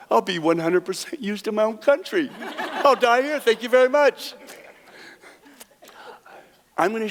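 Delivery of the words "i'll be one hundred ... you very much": laughing disbelief. Real, not acted. Card player laughing off a mobster's deadly offer. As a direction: chuckle through an alarmed thank-you.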